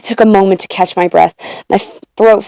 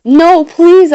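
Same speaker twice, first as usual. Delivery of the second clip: high-pitched, urgent plea; begging; 11 semitones higher, 4 dB louder than usual. A narrator's reading. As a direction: beg them to stop, voice leaping up. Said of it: "I begged".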